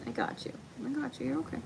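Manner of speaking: tender hush for a scared pup; hushed pet talk. Real, not acted. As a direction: whisper comfort to the dog. Comforting dog, hushed high.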